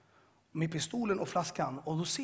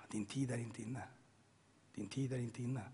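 low, soft voice from nowhere; mysterious inner voice; 6 semitones lower, 9 dB quieter than usual. Retold or imitated. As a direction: say it quietly and evenly, like a voice in the head. Voice he hears while strapped down. (låg, dämpad röst i huvudet)

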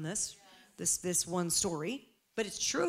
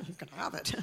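laughing; 4 dB quieter than usual. Pretend. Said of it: "It's like".

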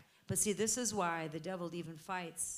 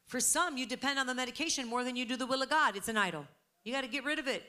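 ordinary delivery

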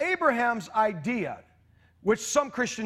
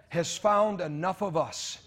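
normal talking voice

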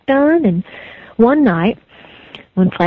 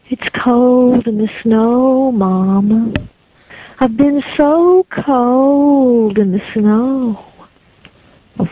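put-on slow, drawn-out ghostly child; 3 semitones higher, slower than usual.

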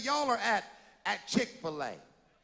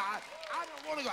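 put-on whiny child; 4 semitones higher, 6 dB quieter, much faster than usual. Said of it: "child whining in acted scene".

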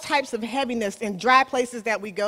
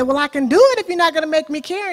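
captain's quick excuse; 6 semitones higher, 7 dB louder, much faster than usual. Retold or imitated. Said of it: "He said".

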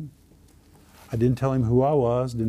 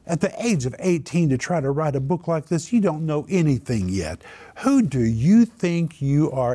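higher, louder, building into an angry rebuke; reaming him out, higher and louder at the end; retold scolding; 5 semitones higher, 4 dB louder than usual. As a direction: scold the upstart for his nerve. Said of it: "he literally reamed me out".

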